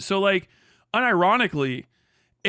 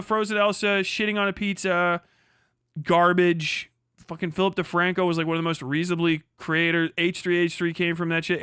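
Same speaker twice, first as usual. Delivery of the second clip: ordinary talk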